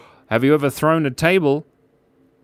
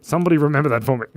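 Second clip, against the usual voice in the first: ordinary talk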